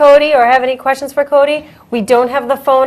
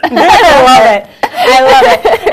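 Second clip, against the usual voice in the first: laughing; 3 semitones higher, 5 dB louder, much slower than usual. Real, not acted. Delighted by the announcer read.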